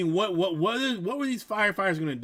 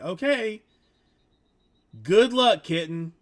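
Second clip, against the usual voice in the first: mock cheery good luck, louder and higher; sarcastic good luck; 5 semitones higher, 7 dB louder than usual. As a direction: toss off a loud, high, sarcastic farewell. Sarcastic send-off retold.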